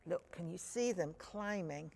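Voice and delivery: plain reading voice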